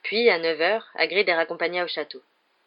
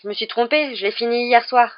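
louder, higher sudden correction; abrupt change of mind; 5 semitones higher, 7 dB louder than usual. A narrator's reading. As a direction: backtrack abruptly in a raised voice. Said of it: "dit soudain Harry".

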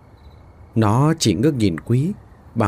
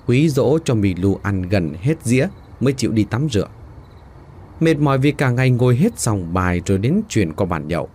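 plain reading voice

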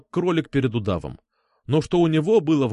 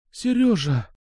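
higher-pitched, choked-up woman's cry; tearful; 7 semitones higher, slower than usual. A narrator's reading. Альфа calls Серёжа on the phone, tearful. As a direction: say the name through swallowed tears. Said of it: "глотая слёзы".

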